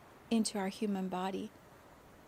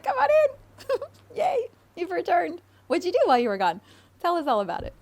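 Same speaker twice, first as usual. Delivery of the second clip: squealing, very high and loud; gushing party host, near-crying with joy; ecstatic welcome; 9 semitones higher, 10 dB louder than usual. Retold or imitated. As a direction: throw open the doors with glee. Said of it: "The happy clam party's like".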